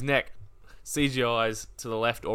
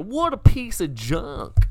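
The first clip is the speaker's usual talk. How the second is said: higher, louder, slow mock exclamation; acted movie quote; 8 semitones higher, 7 dB louder, slower than usual. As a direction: draw out the quoted outburst, lightly hammed up. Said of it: "and go".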